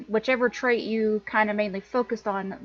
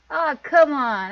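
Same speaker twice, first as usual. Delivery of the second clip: loud, higher-pitched "aw" of complaint; playful protest; 5 semitones higher, 7 dB louder than usual. Acted. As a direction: protest like a spoilsport was spoken to. Character protests being scolded in game.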